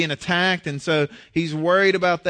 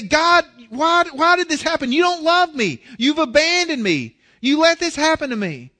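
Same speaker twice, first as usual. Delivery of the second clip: higher, louder accusing cry at God; hurt complaint; 8 semitones higher, 4 dB louder than usual. Retold or imitated. Preacher voicing a believer accusing God, higher and louder. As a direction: blame God, wounded and loud. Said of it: "we see our circumstances and we say".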